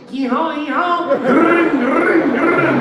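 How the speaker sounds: very high, loud whooping and trilling; imitated ruckus. Wife making a ruckus as the neighbor admires her car, whooping. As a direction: let loose loud nonsense noises.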